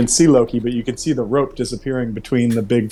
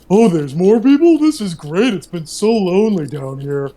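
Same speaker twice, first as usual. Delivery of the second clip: high, delighted creature voice, tapering off at the end; a lonely giant thrilled to see visitors; happy lonely walrus; 8 semitones higher, 3 dB louder than usual. Acted. DM voices the walrus greeting the party, high and delighted. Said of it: "the creature looks over and goes".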